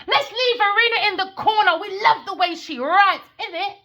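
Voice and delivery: high, loud sneering sweet-talk; mocking imitation of a fawning woman; mocking Adiwali. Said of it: "And Adiwali said".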